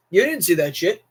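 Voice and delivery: normal talking voice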